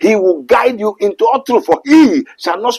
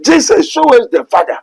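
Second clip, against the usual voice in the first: higher pleading request; preacher voicing Thomas's plea, raised pitch; Thomas asking; 8 semitones higher than usual. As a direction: ask it like an eager disciple. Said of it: "What I say?".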